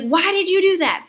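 high-pitched mock scolding; exasperated parent's outburst, half performed; imagined yell. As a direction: act out the yell you held back. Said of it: "I wanted to yell at him".

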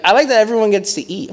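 high, loud, quick excited voice; eager friend. Retold or imitated. Friend gushing about eating at family's house, high and fast. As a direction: blurt it out brightly like a happy kid.

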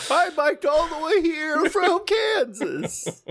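put-on high, slow, whiny old man. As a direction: quaver it like the old biker. Imitating the old guy.

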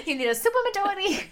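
high, loud put-on quote; imitated show line. Interrupting a friend's stumble with the Succession line, silly imitation. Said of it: "The Succession".

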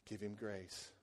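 low, soft voiced inner prompting; quiet prompting. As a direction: imitate a still, small voice. Holy Spirit's words, repeated.

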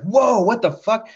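sudden high startled whoa; jolted by the blast, blurting it out. Retold or imitated.

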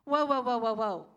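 mock-alarmed stop-right-there; alarmed whoa. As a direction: hold on, caught off guard. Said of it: "I'm sitting there in my seat going".

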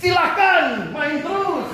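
teasingly over-the-top approval; mock permissive God. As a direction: play it like an indulgent yes-man. Playful God voice.

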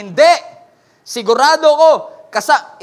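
loud and sure, pitched high; a bystander shouting that he recognises Peter; insistent loud accusation. Acted. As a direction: point him out for all to hear.

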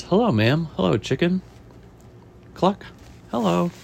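lilting storybook greeting; welcoming chef. Acted. Chef greeting a woman and her chicken, lightly acted welcome.

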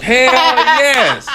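loud, high, drawn-out cheer of agreement; big stretched-out exclamation; emphatic agreement. Real, not acted. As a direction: holler your wholehearted approval. Emphatic agreement with coworker's joke.

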